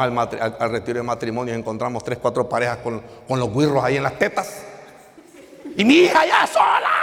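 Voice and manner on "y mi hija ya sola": high, loud cry of alarm; alarmed shout. Retold or imitated. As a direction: yell it in mock panic. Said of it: "decía yo". (grito alarmado por la hija)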